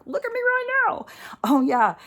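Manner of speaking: high-pitched hyped-up cry; super charged. Acted. Showing how charged up people get, hyper and high. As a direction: burst out, way up high.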